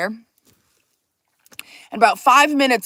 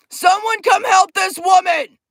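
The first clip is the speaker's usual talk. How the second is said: high, slow mock holler; calling out; 7 semitones higher, 4 dB louder, slower than usual. Pizza guy calls for someone to help, hollering. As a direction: holler slowly for a coworker.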